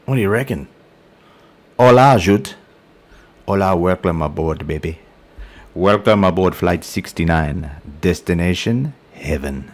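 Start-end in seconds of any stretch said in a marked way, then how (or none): 1.8-9.7 badly faked Spanish accent, almost Indian; dodgy Spanish accent. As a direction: play the airline host in a dodgy Spanish accent. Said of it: "a dodgy Spanish accent".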